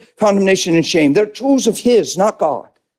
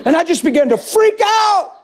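ordinary talk

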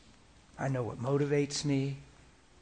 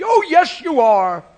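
much higher and louder, insisting on every word; emphatic loud reply; 12 semitones higher, 13 dB louder, slower than usual. Retold or imitated. Jesus firmly contradicting his disciples, loud. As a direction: hammer it home.

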